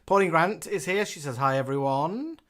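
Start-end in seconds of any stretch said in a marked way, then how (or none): none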